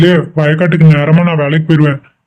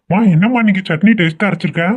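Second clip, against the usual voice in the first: ordinary talk, nothing marked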